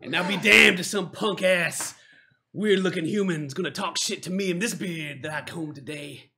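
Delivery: lightly voiced dwarf scorn, loud then settling; slowly scorn the human as a proud dwarf; indignant dwarf. Dwarf resumes threat after chanting.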